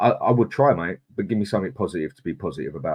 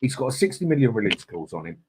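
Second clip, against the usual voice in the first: normal talking voice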